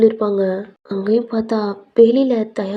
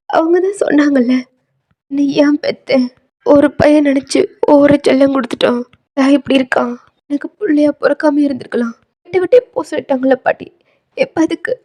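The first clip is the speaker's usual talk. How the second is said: higher, mildly wounded complaint; hurt grandson; 4 semitones higher, 4 dB louder than usual. Acted. Grandson telling grandmother of parents' hurtful words, wounded protest. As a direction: pour out hurt as the grandson, pitched up.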